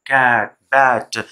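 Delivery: "कैट, बैट" stretched slowly with a flat, open "a" as in "cat"; slow vowel demo. Shown as a demonstration. Teacher slowly modelling the cat vowel for learners. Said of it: "ये sound से पूरे symbols".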